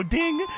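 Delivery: high-pitched imitated bell; mimicked buzzer-bell ding; lie detector ding. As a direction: sing out a high little ding. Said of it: "a lie detector test go".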